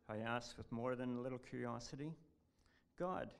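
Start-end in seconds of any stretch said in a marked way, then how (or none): 3.0-3.3 plaintive. Retold or imitated. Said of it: "he said plaintively".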